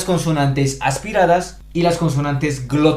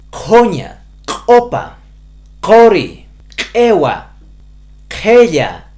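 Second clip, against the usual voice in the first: each of "joña", "opa", "cori", "ewa", "jeya" called out loud and higher; loud examples; 4 semitones higher, 6 dB louder, much slower than usual. Shown as a demonstration. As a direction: project every example word. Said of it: "Por ejemplo". (ejemplos dichos en voz alta)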